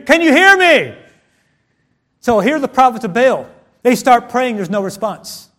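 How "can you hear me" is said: high, loud yell to be heard; much louder. Shown as a demonstration. Repeating to someone not responding, shouted louder.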